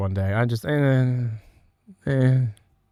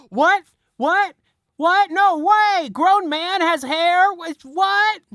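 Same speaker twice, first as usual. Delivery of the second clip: squeaky high gasping disbelief; mock shock; much slower than usual. Joke victim reacting to the bald spot, high mock shock. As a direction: act stunned, way up high.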